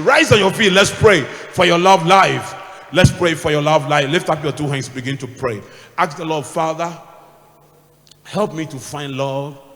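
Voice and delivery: loud, slow call to prayer; full-force altar call; preaching prayer. Acted. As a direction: rouse the congregation to pray. Preacher leads congregation in prayer.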